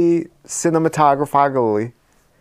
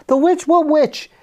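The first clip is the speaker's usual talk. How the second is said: disbelieving question. Retold or imitated. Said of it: "He's like".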